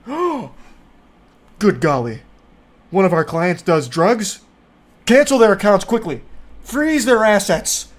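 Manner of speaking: gasping, hammed-up shock, building to urgent orders; mock outrage. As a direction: play a scandalized banker in fake alarm. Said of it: "they're gonna get outraged, like".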